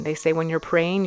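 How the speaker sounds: normal talking voice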